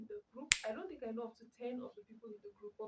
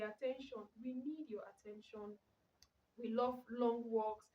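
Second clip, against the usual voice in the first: ordinary talk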